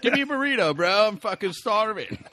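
high, loud mock demand; hammed-up bro begging for food, dragging out the end; hungry dude. Co-host playing a starving Greek as a bro, hammed-up plea.

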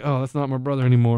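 low, soft, quick disowning; mock disappointment, dropped low and quiet; deflated. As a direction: let the pride drain out, quickly. Deflated after brother got blocked.

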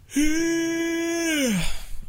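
long, high, sustained yawn; imitated yawn. Songwriter re-enacts leaning back in his chair, high musical yawn. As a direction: yawn out on one high held note.

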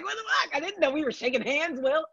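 high, fast, thrown-off protest; mock-indignant, caught off guard by a handshake; squeaky quick aside of disbelief; startled disbelief. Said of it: "And he was like".

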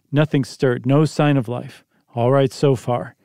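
ordinary delivery, nothing marked

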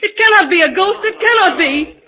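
high, loud and fast disbelief; panicked denial. Acted. Stella panics at the invisible voice, loud and fast. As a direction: deny it in rising panic.

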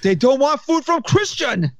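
the Egyptian boss lecturing his worker; Egyptian accent. Retold or imitated. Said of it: "Pretty good Egyptian accent".

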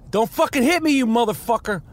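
sudden high angry shout at a driver; angry yell. Real, not acted. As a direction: yell it at the car about to hit you. Yells at traffic crossing red light.

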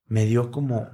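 usual speaking voice

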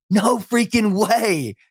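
slow, high, drawn-out disbelief; stunned disbelief. Retold or imitated. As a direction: let it stretch out in shock, voice up high. Stunned at his first paycheck.

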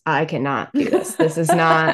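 retold refusal dissolving into laughter; real laughter taking over the words; breaking into laughter. Real, not acted. Breaks into laughter retelling her refusal.

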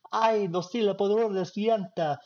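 high, hammed-up soap opera cry; telenovela drama. Mocking telenovelas for cohosts, high melodramatic soap voice. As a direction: overplay it like a telenovela star. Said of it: "like watching one of those telenovela".

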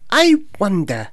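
bunny voice. Acted. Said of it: "he thought".

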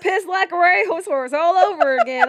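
bad Texas accent. Guard excusing himself for a restroom break, bad Texas accent. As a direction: push the fake Texas accent loud and high. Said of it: "the worst Texas accent I've ever done".

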